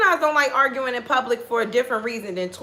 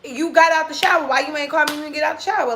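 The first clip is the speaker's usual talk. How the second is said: exasperated retelling; 5 semitones higher than usual. Retold or imitated. Retold fight with boyfriend.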